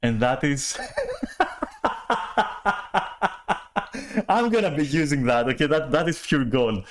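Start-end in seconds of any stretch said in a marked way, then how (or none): 0.8-4.2 long high cackle; cackling. Real, not acted. Cackling over the new term she learned. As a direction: cackle helplessly.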